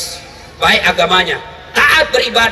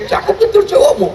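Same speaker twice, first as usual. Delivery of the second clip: teasing remark; 10 semitones higher than usual. Retold or imitated. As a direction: tease the student playfully. Teacher teasing student. (ustaz menggoda mahasiswi, suara tinggi sambil tertawa)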